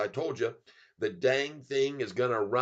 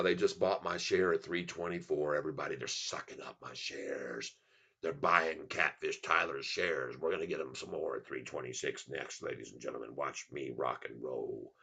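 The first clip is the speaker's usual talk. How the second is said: low showman voice; 10 semitones lower, 5 dB quieter than usual. Acted. Streamer tells everybody his shares sell, very low showman voice. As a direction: drop right down and stretch it out. Hamming up share sales to viewers.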